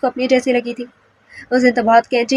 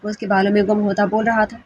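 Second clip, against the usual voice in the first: plain reading voice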